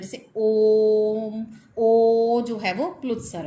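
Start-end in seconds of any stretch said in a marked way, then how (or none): 0.0-1.5 ओम held very long and slow, the ओ stretched; prolonged vowel demo. Shown as a demonstration. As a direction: draw out ओम to triple length, slowly. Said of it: "तीन गुना समय लगता है".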